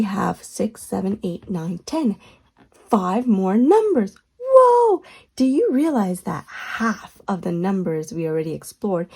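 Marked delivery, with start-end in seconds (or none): 4.4-5.0 excited whoa. Acted. Excited about halfway point.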